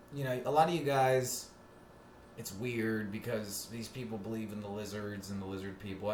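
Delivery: ordinary delivery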